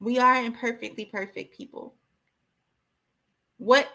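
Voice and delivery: normal talking voice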